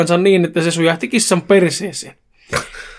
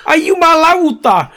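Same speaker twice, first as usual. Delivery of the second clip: high, loud yell of pain; acted agonised curse, drawn out; pained shout; 12 semitones higher, 5 dB louder, slower than usual. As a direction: cry out as if just bitten. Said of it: "huusi Make tuskissaan".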